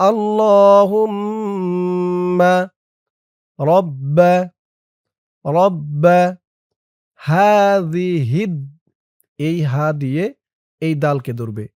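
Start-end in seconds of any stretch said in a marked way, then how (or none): 0.1-8.5 হাযিহিদ held very slow with a rise; drawn-out recitation. Shown as a demonstration. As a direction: stretch রাব্বা and হাযিহিদ. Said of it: "তেমন টান দিবেন". (টেনে টেনে ধীরে উচ্চারণ)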